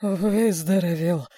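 slow, barely moving tongue; mumble, drained and worn out; exhausted mumble. A narrator's reading. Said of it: "еле ворочая языком, пробормотал Потапов".

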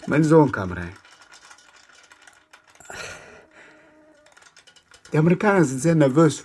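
the memory overwhelms him and he blurts it out; loud, fast, high-pitched outburst; agitated outburst. Acted. Father recounting his son's shooting.

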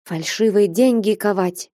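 usual speaking voice

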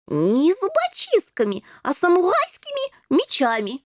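high-pitched playful kitten voice; joking kitten. A narrator's reading. Ginger kitten joking with the princess, playful high voice. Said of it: "пошутил Рыжий".

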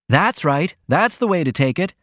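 high, breezy approval; airy. A narrator's reading. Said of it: "the cub announced airily".

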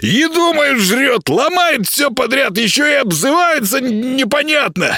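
high-pitched, scolding creature voice; grumpy little house spirit. Acted. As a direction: nag like an indignant tiny housekeeper.

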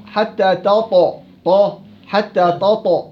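حتى تطع with the ت turned into ط, slow; slow demo of ت made ط; wrong heavy taa. Shown as a demonstration. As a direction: pronounce تطع as طاطع slowly. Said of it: "طاء، طاء غلط".

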